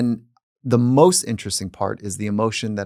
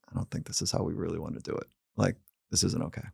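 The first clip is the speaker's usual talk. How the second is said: quiet father; 4 semitones lower, 11 dB quieter, faster than usual. Retold or imitated. Father talks to son after door slam.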